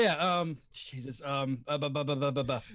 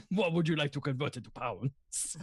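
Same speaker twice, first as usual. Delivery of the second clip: Russian dwarf; 6 semitones lower than usual. Acted. The other barkeeper serving a customer, low Russian dwarf accent. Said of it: "the Russian dwarf sounding one".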